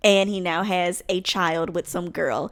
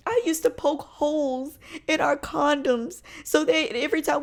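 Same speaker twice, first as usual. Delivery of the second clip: crying ex voice; 6 semitones higher, 4 dB louder than usual. Retold or imitated. Crying ex pleads on phone.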